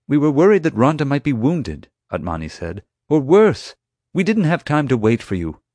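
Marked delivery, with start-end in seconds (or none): 0.1-1.9 higher, louder earnest protest; lightly voiced man defending himself urgently; hint of worried Atmani. Atmani justifies entering the room to the chief, insistent. 3.1-5.6 much higher, louder protest; insistent Atmani. Acted. Atmani justifies not waiting for security, insistent. Voices Atmani defending himself.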